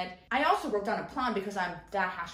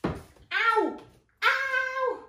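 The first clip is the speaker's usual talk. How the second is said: real yelp of pain; 14 semitones higher, 5 dB louder than usual. Real, not acted. Books falling on her.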